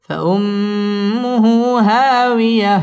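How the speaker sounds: slow فأمه at normal pitch with nasal hold. Shown as a demonstration. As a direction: hold the ghunna, keep it low. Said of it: "ওয়াজিব গুন্না". (নিচু সুরে গুন্না সহ)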